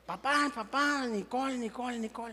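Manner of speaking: put-on tattle to daddy like a toddler; tattling little girl. Father imitating his small daughter telling on her sister, whiny and soft.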